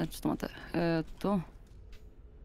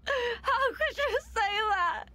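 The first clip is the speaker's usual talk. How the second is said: mock crying; 10 semitones higher, slower than usual. To her teasing partner, a hammed-up tearful wail.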